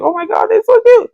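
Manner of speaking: high, delighted squeal; high excited gush. Retold or imitated. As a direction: gush over the cute little octopus. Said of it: "And I was like".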